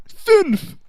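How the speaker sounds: high, loud mock-German cry; shouted punchline. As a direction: yelp the punchline.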